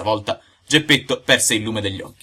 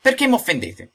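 high, loud indignant outburst; furious high-pitched demand; enraged; 13 semitones higher, 5 dB louder than usual. A narrator's reading. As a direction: flare up in offended fury. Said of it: "gli disse imbestialito".

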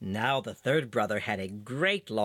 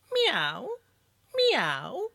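high, insistent mew, lifting at the end; cat's meow; 11 semitones higher than usual. A narrator's reading. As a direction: answer with a pressing, high-pitched cat cry. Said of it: "But Puss, whose thirst became every moment more and more pressing, answered nothing but".

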